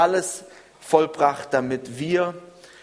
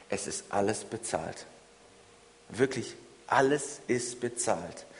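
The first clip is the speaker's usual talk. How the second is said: quiet; 6 semitones lower, 8 dB quieter than usual. Real, not acted. Sermon refrain said hushed.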